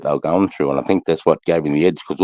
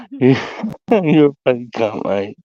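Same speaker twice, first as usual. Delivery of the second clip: real helpless laughter, high-pitched; cracking up at a mate's joke; laughing hard; 11 semitones higher, 3 dB louder, slower than usual. Real, not acted. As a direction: squeeze the words out through laughter.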